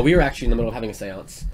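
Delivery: normal talking voice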